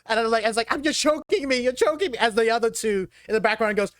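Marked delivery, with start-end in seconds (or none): 0.7-2.2 high-pitched panicked complaint; hammed-up choking victim; choking thug. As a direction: protest while held up by the collar. Held up by the collar.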